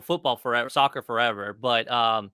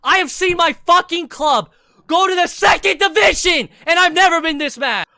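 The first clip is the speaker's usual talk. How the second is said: furious fan rant; 12 semitones higher, 9 dB louder than usual. Real, not acted. Fan raging over his club's relegation, furious shouting. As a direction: rant at full voice, stretching the cries.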